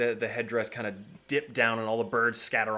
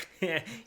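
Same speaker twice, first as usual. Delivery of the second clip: high little giggle with a snort; giggle, then snort; 6 semitones higher than usual. Acted. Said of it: "a giggle snort".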